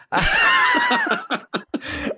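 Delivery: high, bursting laugh; real laughter. Real, not acted. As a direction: crack up at your own bit. Laughs at own interview impression.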